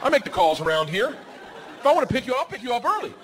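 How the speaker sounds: hammed-up swagger; mock bravado. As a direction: puff up like the boss of the house. Dad hyping himself up, mock bravado.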